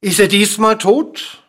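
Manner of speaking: loud, high rising question; calling out. A narrator's reading. King asking if the dwarf is dead, calling out. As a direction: call the question down to him. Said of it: "rief der König".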